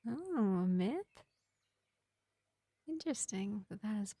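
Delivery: lightly voiced vampire healer, projected above the chatty voice; gentle vampire medic. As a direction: play a measured vampire reassuring a wounded elf, with long pauses. Plays vampire medic to an elf.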